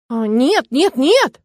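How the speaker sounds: panicked rush of refusals; rapid pleading no. A narrator's reading. Nastya begs Bran not to tie her. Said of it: "зачастила я".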